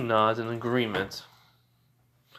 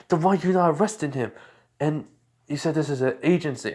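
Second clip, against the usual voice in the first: shocked; 5 semitones higher than usual. Acted. Night Eye shocked at the hero agency.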